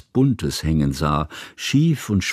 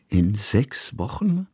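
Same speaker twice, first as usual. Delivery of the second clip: surprised repeat; slower than usual. A narrator's reading. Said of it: "wiederholte Kusmin".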